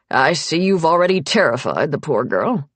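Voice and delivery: lightly voiced older man, dry; a hint of a blunt old patriarch teasing; gruff old father. Old patriarch teasing his wife about the guest, lightly gruff.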